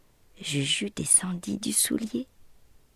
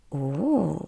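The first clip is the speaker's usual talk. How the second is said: surprised oh; 2 semitones lower than usual. A narrator's reading. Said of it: "s'exclama-t-il".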